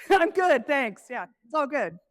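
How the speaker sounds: high, bright "I'm good" brushing it off; fake cheerful reply. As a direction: chirp it out, masking the strain. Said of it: "you're just smiling".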